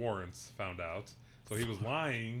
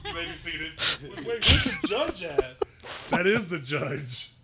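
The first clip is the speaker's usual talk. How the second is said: animated laughing retelling; 8 semitones higher, 6 dB louder than usual. Retold or imitated. Acting out zoom court scene.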